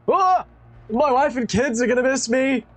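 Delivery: high, pitiful plea of a doomed man; pleading captive. Acted. Doomed captive pleading with his attacker, high and pitiful. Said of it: "he looks up and goes".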